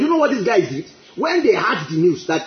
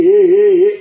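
loud, drawn-out mock crying "hey"; long hammed-up wail; 9 dB louder, slower than usual. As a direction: imitate a crowd wailing aloud. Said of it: "Five million people crying".

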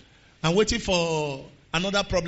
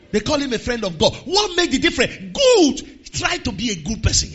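normal talking voice

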